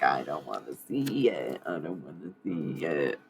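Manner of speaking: a droning, sulky mock of reluctant clients; mocking whiny people. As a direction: parody people who refuse to look. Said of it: "people are like".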